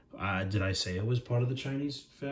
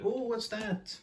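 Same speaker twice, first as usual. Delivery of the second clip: startled squeak; 13 semitones higher, 4 dB quieter, slower than usual. Acted. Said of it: "he was like".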